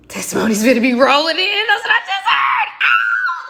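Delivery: laughing shout climbing very high; real excitement rising into a shriek; excited squeal. Real, not acted. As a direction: burst into a high, gleeful squeal. Excited outburst at the sign-off.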